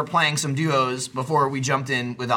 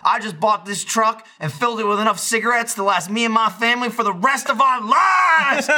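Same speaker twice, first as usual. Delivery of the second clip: gloating sketch boast; 7 semitones higher than usual. Acted. Quoting the newly rich man in a sketch, exuberant shouted boast. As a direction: crow it, stretching the end into a yell.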